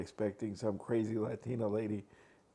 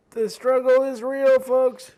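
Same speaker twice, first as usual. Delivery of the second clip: high, loud call to the audience; loud mock complaint; 11 semitones higher, 11 dB louder, slower than usual. Real, not acted. Vlogger fumbling with a plate hollers to viewers, mock-exasperated. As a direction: belt it out as comic exasperation.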